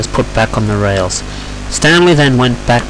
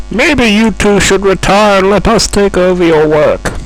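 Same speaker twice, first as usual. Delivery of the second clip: higher, needling put-on voice; taunting; 9 semitones higher than usual. Young engine telling two others to retire, taunting. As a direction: goad the older pair.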